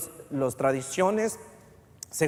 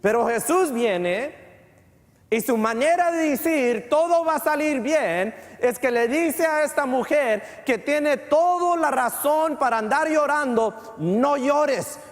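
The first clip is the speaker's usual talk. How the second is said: higher, ringing sermon voice; announcing to the church that Jesus has arrived; fervent preaching; 8 semitones higher than usual. Acted. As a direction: proclaim it with triumphant conviction. Preaching at full force.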